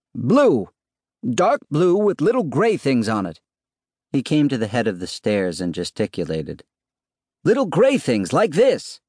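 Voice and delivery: high-pitched, emphatic description; calling down. A narrator's reading. Husband describing his scarf to wife below, calling down. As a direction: describe it so it carries downstairs.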